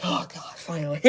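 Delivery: breathy, voiceless sigh of mock relief; exhausted relief. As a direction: act wiped out, breathing it out into a laugh. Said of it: "if you saw how I was after".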